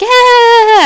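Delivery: a cousin smugly owning the prank. Retold or imitated. Cousin admitting the wine glass prank, high smug yeah. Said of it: "And there's like".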